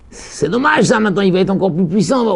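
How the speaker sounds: ordinary delivery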